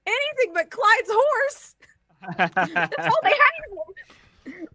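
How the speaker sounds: high, hammed-up wail; mock panic. Game master mock-wailing over the player's runaway horse. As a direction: squeal it in mock despair, slowing down.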